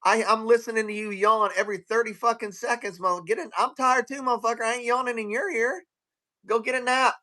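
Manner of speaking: high, indignant telling-off; acted exasperation. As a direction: rant at someone yawning in your ear. Acted rant at yawning agent.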